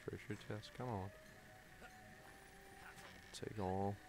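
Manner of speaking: normal talking voice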